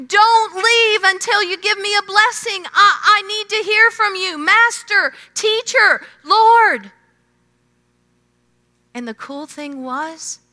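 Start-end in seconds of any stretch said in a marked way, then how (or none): 0.1-6.9 high, loud desperate begging; pleading cry. Retold or imitated. Beggar pleading with Jesus, high loud begging. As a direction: beg him not to walk away. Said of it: "I can just hear him like Jacob saying".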